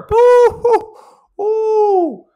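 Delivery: high mock crying boo hoo. Vlogger mocks headwind misery with a high fake boohoo. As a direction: whine like a baby about the wind.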